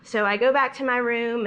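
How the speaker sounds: ordinary delivery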